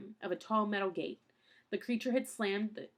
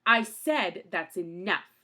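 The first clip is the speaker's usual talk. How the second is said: louder, slow and firm; stern growl; 9 dB louder, slower than usual. A narrator's reading. Mister Poe silencing Count Olaf, slow loud growl. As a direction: lay down the law, slowly and loud. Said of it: "Mister Poe growled".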